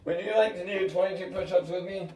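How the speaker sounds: ordinary talk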